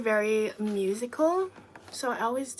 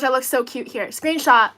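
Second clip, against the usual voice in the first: ordinary delivery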